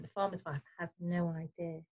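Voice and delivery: ordinary delivery, nothing marked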